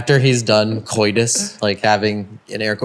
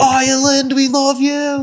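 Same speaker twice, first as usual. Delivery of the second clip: high-pitched mock stage shout; emcee yelling; 13 semitones higher than usual. Tribute singer to the crowd, imitated emcee yell. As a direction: hype up an imaginary concert crowd. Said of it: "him yelling and, like, M C ing".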